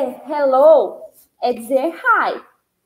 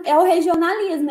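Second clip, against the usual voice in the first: ordinary talk, nothing marked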